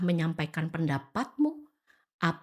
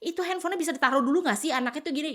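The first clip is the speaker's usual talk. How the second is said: nagging attack; 7 semitones higher, much faster than usual. Retold or imitated. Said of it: "yang kamu serang adalah".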